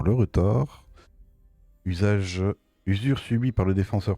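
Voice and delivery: usual speaking voice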